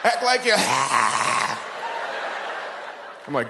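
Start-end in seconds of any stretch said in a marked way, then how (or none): 0.0-0.5 higher, louder hammed-up sermon; mock fiery preacher; phlegmy preacher. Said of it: "He'd be like". 0.6-2.9 hammed-up hack; phlegm hack. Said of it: "phlegm caught in his throat".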